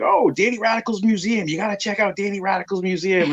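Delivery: high eager fan voice recommending it; excited fans. Retold or imitated. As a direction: hype it up like a fan. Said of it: "they're like".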